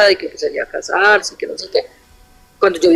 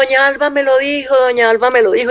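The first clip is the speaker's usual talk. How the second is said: retold yelling; 5 semitones higher than usual. Neighbour girl crying out in front of the house, retold yelling. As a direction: imitate a neighbour's repeated outcry, pitched up. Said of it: "Y gritaba".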